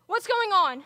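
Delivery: loud, high, frantic cries for help; panicked shouting. Acted. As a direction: yell in panic as a parent crashes. Father's oxygen drops, she panics.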